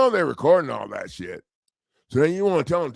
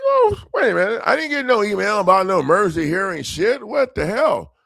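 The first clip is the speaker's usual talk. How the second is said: high, louder outraged disbelief; retold outrage, pitched way up; indignant surprise; 8 semitones higher, 4 dB louder than usual. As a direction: throw up your hands, voice jumping high. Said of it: "I'm like".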